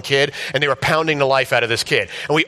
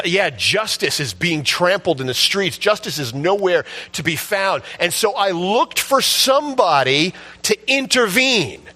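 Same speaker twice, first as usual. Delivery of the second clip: preaching voice; 7 semitones higher than usual. Acted. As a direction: preach God's lament with rising fervour. Voices God in full preaching.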